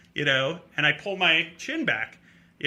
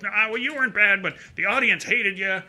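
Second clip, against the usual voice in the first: higher, blunt tipsy backpedal; lightly voiced drunk; 6 semitones higher than usual. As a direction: imitate a drunk trying to soften an insult. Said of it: "And the guy's drunk".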